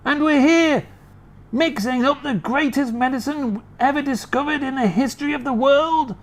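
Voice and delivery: high, worked-up rant; excited Mister Cranky. Acted. Mister Cranky ranting to his wife, worked up. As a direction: rave about the medicine.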